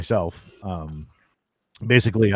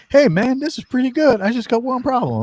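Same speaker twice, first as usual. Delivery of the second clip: high, louder put-on buddy voice; friend's voice; 6 dB louder than usual. Friend calls with feedback, high put-on buddy voice. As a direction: play the pal calling with notes.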